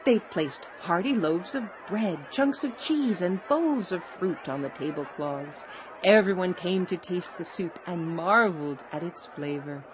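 ordinary delivery

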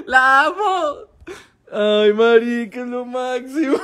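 high, teary laugh of joy; overjoyed laughter breaking into tears; laughing, almost crying. Real, not acted. Streamer overjoyed as the neighbor waves back, laughing tearfully.

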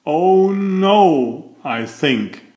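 usual speaking voice

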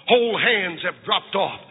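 ordinary talk

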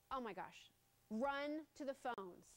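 normal talking voice

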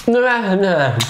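ordinary delivery

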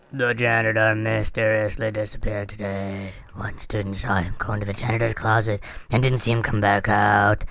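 low, slow creepy voice. Shown as a demonstration. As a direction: drag it out low and eerie. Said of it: "she have this creepy voice like this".

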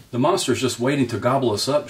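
lightly shrieked in alarm; mild shriek. A narrator's reading. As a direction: warn the others with a touch of panic. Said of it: "shrieked Dig Down".

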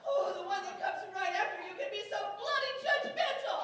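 loud, high angry retort; furious shouting. Acted. Wife topping her insult to husband, furious shout. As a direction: hurl the accusation at full volume.

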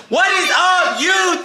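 big loud hype greeting; hyped-up intro shout. Shown as a demonstration. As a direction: shout it like a YouTuber. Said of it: "That was not it".

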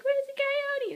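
high questioning cow. Acted. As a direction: call out looking around. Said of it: "he's all like".

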